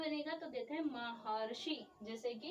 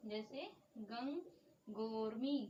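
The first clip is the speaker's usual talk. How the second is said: गंगोर्मी drawn out slowly, syllable by syllable; slow repeat; much slower than usual. Shown as a demonstration. Teacher repeating the word slowly for students to copy down.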